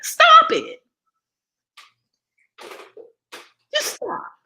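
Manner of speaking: sharp high scolding stop. Real, not acted. Mother scolding listeners who eat junk, high and sharp. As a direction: cut them off, high and urgent.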